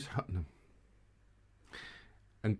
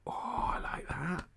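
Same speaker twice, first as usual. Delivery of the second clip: breathy little whisper of delight; whispered aside; 7 semitones higher, 6 dB quieter than usual. Real, not acted. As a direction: lean in and whisper it. Pleased aside to co-host.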